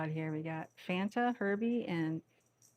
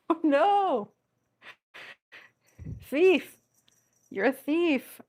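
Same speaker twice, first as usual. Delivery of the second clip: high, loud teasing; mock-scold the cat; playful scolding; 8 semitones higher, 7 dB louder, much slower than usual. Real, not acted. Cat steals the toy.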